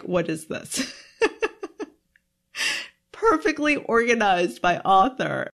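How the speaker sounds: laughing through the words; amused, laughing disbelief. Retold or imitated. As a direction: chuckle at how neat it is. Amused parent marvels at bookshelf.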